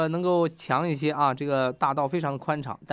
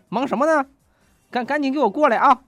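high, loud phone demand; bossy summons; 9 semitones higher, 6 dB louder than usual. Retold or imitated. Husband on phone summoning wife, loud and bossy. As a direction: order them over, voice raised high.

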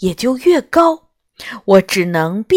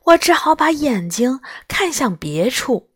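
ordinary delivery

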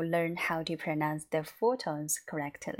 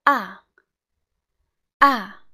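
short, very loud "ah" falling from high; forceful falling "ah"; fourth tone; 4 semitones higher, 14 dB louder than usual. Shown as a demonstration. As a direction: drop "ah" hard and fast. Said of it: "read all together again from the beginning".

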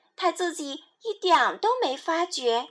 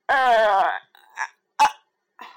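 loud hiccup sounds; 4 semitones lower, 5 dB louder, slower than usual. Acted. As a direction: hiccup three times. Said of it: "斑马回答。".

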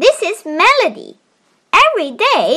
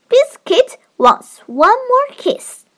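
put-on reading to a toddler; 5 semitones higher than usual. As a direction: read it gently to a little one. Child reads picture book aloud.

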